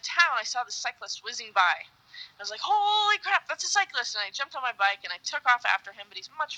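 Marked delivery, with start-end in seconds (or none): 2.6-4.2 high-pitched, thrilled outburst; acted-out excited discovery; excited exclamation. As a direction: blurt it out in amazement, retelling it. Acts out spotting the cyclist.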